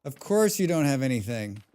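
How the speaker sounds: emphatic, exasperated repeat; voiced complaint. Real, not acted. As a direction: say it out to the empty-handed foe.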